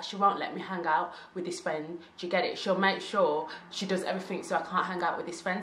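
ordinary talk, nothing marked

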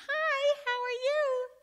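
put-on very high, drawn-out kid greeting; high, loud small-child hello; little child's voice. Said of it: "The voice comes again".

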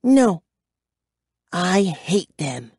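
high denial, then slow and deliberate; declaring with hatred. A narrator's reading. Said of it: "she declared".

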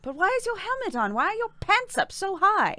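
high, exasperated questioning, lightly acted; Rey. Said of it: "you're playing as Rey".

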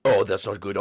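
normal talking voice

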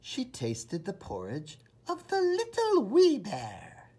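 high, tiny voice for the wee bear; high little wee voice. A narrator's reading. Narrator pipes up high for the wee bear's porridge. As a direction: go small and high.

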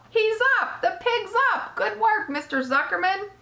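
high-pitched jubilant shouts; excited crowd yelling. A narrator's reading. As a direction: burst out cheering, one exclamation after another. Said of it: "yelled the crowd".

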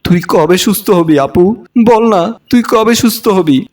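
ordinary delivery, nothing marked

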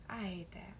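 a quick breathy aside; whispered. Real, not acted. Telling viewers her pet peeve, softly whispered. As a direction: drop to a whisper to hate on it.

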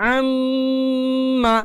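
আম্মা with the মম drawn long through the nose; held nasal ghunnah. Shown as a demonstration. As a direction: hum the মম in আম্মা slowly. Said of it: "নাকের বাঁশি হতে একটা গুনগুন আওয়াজ".

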